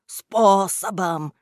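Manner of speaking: slow, drawn-out jeer on "Способом"; mocking echo. A narrator's reading. Boss mimicking henchman's excuse, slow mocking echo. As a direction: repeat his word back mockingly, stretching it. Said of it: "передразнил его Кляксич".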